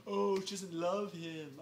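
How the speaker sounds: slow, drawn-out sappy whine; hammed-up sentimental "oh"; mock lament. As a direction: draw it out in mock sympathy. Mocks the woman's excuse.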